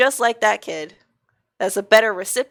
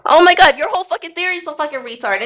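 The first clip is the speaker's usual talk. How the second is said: loud, high burst of outrage; outraged shout; 8 semitones higher, 5 dB louder, faster than usual. Real, not acted. Friend yelling back in a heated argument, loud outrage. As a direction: explode at him.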